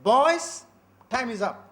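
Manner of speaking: put-on a strict librarian calling the boys in, loud and commanding; stern call to the boys. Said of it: "And she used to say".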